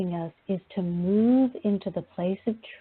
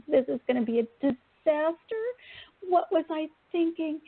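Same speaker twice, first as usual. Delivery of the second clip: mock dismay; 7 semitones higher than usual. Acts out her own dismay.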